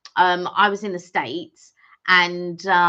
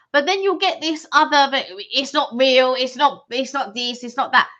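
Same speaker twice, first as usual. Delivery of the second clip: mock complaining; 6 semitones higher, 4 dB louder than usual. Mimicking critics of Dishoom.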